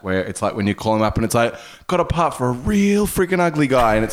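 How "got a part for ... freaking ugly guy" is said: a hammed-up American casting agent, pitched higher; mock American accent. Casting agent phoning an actor, in a mock American accent. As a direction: put on a bad American accent for the phone call. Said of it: "bad American approximations".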